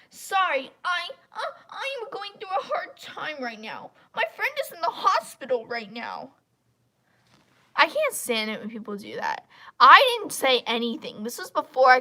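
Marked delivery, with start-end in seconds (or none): 0.1-6.3 higher, mock pleading whine; mock pity plea. Mocking the user's hospital excuse, whiny. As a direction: parody her sob-story excuse. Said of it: "then she goes".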